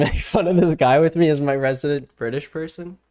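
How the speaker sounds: usual speaking voice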